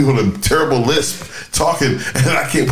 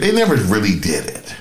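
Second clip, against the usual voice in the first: ordinary talk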